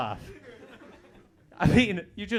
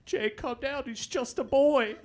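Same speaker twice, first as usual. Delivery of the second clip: higher, soothing mock mom; 9 semitones higher, slower than usual. Comic voicing his mom calming his dad, higher mock mom. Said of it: "My mom's like".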